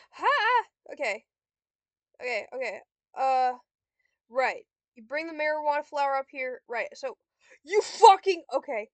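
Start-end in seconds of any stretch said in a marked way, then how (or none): 0.1-0.6 high, loud exclamation; a sharp loud 'ha'; triumphant ha. Real, not acted. Exclaiming during a game move. 7.6-8.4 loud, higher cursing; sudden exasperated burst; angry outburst. Real, not acted. Cursing the computer opponent.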